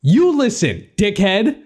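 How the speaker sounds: high-pitched forceful retort; commanding. A narrator's reading. Narrator answers the bellowing caller, commanding. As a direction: throw the order back, stretched for force. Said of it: "I commanded".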